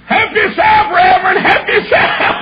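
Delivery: high, loud crying shout; tearful policeman. Retold or imitated. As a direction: cry it out, choked with emotion. Policeman moved to tears.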